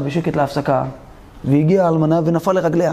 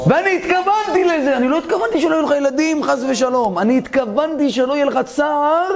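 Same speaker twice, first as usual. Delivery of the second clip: earnest pleading; 7 semitones higher than usual. Retold or imitated. Widow explains her blessing, pleading.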